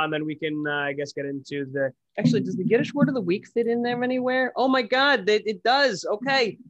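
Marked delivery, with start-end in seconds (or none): 2.2-6.6 excited. Real, not acted. Excited about the word segment.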